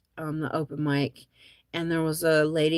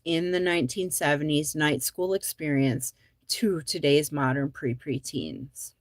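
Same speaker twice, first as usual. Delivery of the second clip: usual speaking voice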